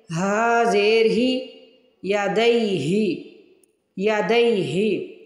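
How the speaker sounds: slow, drawn-out letter spelling; patient slow teacher spelling; slow spelling drill. Shown as a demonstration. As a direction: spell it out slowly for beginners. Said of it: "slow spelling drill".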